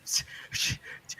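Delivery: mock shushing. Mocking the directors' shushing.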